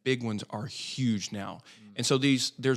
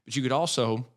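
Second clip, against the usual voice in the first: usual speaking voice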